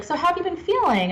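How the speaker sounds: a hint of a mother checking in; imitating a mom. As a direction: voice a mom's question, pitched high. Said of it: "his mom was like".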